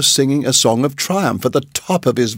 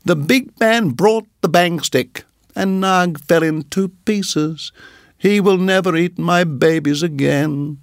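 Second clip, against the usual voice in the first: higher, excited chirpy bird; little bird voice; 5 semitones higher than usual. Acted. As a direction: chatter proudly like a small bird. Darzee the bird's voice.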